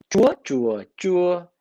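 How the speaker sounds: "chúa, chúa, chúa" on three separate tones; teacher's tone demo on "chúa, chúa, chúa"; Vietnamese tone contrast. Shown as a demonstration. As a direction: make each "chúa" differ only by its tone. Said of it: "With the different accents on the wording".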